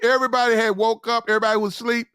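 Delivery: normal talking voice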